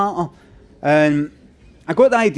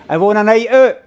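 raised, louder crowd-hyping question; rallying the crowd; 3 semitones higher, 5 dB louder than usual. Real, not acted. Comedian to audience before the stories, rallying call. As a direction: call it out to get a cheer.

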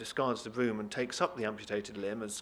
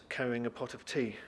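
usual speaking voice

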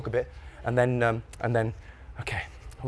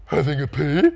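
incredulous questioning reply; as if asked a stupid question; slower than usual. A narrator's reading. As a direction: answer as though it's blindingly obvious. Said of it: "like this was the most stupid question".

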